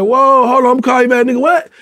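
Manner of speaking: high, loud startled cry; shocked. Retold or imitated. Friend hearing bad news over the phone, shocked cry. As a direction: jolt up in disbelief at the news.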